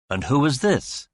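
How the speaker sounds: put-on pleasing American accent. A narrator's reading. Man in sweatshirt asking about the newcomer, American accent. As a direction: ask smoothly in an American accent. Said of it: "he asked in a pleasing American accent".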